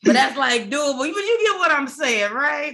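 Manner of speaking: high, animated and eager; excited. Real, not acted. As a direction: push it up with excitement. Excited reaction, pitch high.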